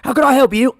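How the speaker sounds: hammed-up cheery room-service operator; hotel staff answering. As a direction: pipe up high and loud, ready to serve. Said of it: "three different people that answer".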